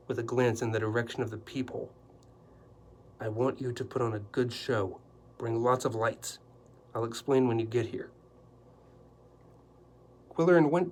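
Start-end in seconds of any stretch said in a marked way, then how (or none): none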